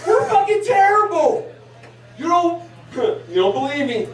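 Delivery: ordinary talk, nothing marked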